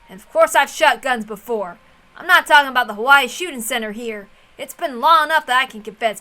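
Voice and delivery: put-on loud, hearty old man bragging; jovial old man reassuring the room with loud war tales; boisterous Hideyoshi. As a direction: boom it out like a jolly uncle boasting of old days. Narrator voices Hideyoshi's war story.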